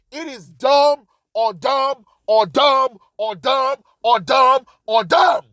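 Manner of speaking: slow beat landing hard on every "dumb"; emphatic repetition. Real, not acted. Ranting that it's dumb, each "dumb" hammered out slowly. Emphatic chant of repeated verdict.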